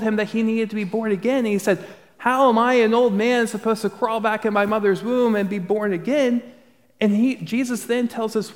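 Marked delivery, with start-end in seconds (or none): 2.2-6.4 higher, louder mock disbelief as Nicodemus; preacher voicing a baffled Nicodemus, higher and louder; incredulous old man. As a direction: toss the question out, baffled and exasperated. Nicodemus confused about rebirth.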